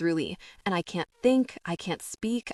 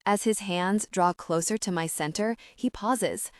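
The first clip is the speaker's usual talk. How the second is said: plain reading voice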